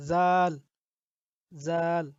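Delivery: soft Arabic জাল; soft tongue-tip zal. Shown as a demonstration. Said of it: "জিহ্বার আগা থেকে একটু নরমভাবে করব".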